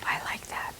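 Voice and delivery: hushed, pleased whisper. Real, not acted. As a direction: whisper your approval. Whispered admiration.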